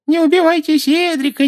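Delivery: high, mocking falsetto wail; thin put-on whine. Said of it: "запричитал тонким, деланным голоском".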